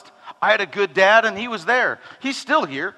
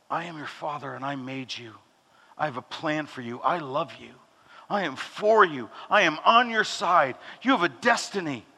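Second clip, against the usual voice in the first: tender father; 4 semitones lower, 5 dB quieter than usual. Acted. Pastor voicing God as a loving father, soft and low. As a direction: lower and much softer, a gentle loving father.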